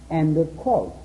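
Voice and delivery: usual speaking voice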